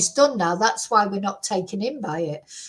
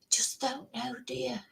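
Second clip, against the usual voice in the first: hushed, secretive whisper; 12 dB quieter than usual. Real, not acted. Whispered aside about poison.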